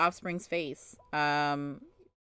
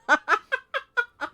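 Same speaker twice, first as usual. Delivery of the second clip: high, loud burst of staccato laughter; exasperated laugh; 6 dB louder than usual. Real, not acted. Parent worn down by offspring, exasperated high laugh. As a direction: let out a high, pealing cackle.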